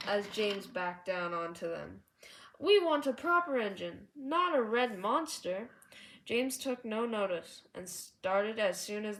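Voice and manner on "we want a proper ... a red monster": louder complaining truck voice; grumbling truck. A narrator's reading. Trucks complaining about James, loud grumbling. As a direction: complain loudly like a sulky truck. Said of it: "said the truck".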